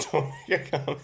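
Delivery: laughing. Real, not acted. Repeats request while laughing.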